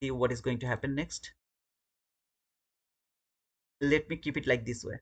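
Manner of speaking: normal talking voice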